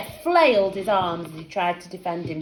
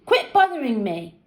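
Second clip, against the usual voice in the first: usual speaking voice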